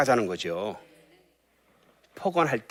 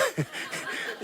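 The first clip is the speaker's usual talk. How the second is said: high breathy laugh; 6 dB quieter than usual. Real, not acted. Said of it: "예, 이거는 막".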